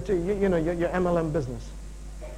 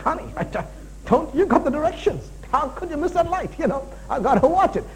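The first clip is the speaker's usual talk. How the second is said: higher, lightly acted exasperation; mock impatient husband; 8 semitones higher than usual. Husband in the car correcting his wife, mock impatience. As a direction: play at nagging over a missed turn.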